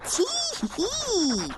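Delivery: a little mocking snicker; cheeky tee-hee giggle; sly giggle. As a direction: snigger like a naughty boy. Henry giggles at his joke.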